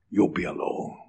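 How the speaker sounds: breathy whisper. Real, not acted. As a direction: breathe the words for weight. Repeated, breathed for emphasis.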